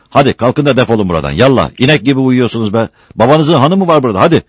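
loud, high, impatient scolding; cursing angrily. A narrator's reading. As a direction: rail at lazy sleepers. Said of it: "kötü kötü sövüp duruyordu". (kızgınca söverek, yüksek sesle)

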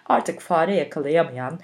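usual speaking voice

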